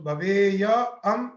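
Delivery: drawn out, syllable by syllable; slow spelled-out form. Shown as a demonstration. Teacher building the form for students, slowly drawn out. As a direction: lay out भवेयसी slowly so students follow. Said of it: "मिला दीजिए".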